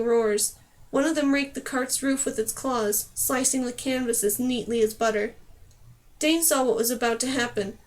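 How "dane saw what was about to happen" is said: ordinary delivery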